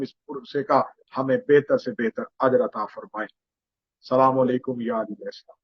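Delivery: low, subdued recited supplication; closing prayer. Acted. Preacher closes the majlis with a dua, low and subdued.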